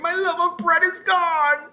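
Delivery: high, loud, slow anguished cry; desperate wail. A narrator's reading. Ellen crying to Murdoch about her bread, desperate wail. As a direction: wail over the lost bread.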